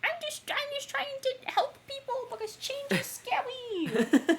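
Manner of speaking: high squeaky laughing villain voice; mad scientist. Retold or imitated. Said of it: "and he's like".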